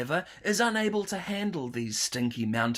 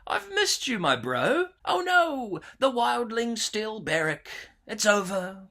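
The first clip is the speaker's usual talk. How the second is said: high, squealing fan excitement; excited; 6 semitones higher than usual. Acted. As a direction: gush like an overjoyed fan. Mock fan excitement at return.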